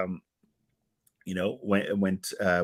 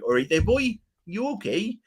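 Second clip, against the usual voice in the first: hammed-up British chat, starting high; put-on British accent; 4 semitones higher than usual. Shown as a demonstration. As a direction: play up a cheery British greeting. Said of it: "I can do British accents".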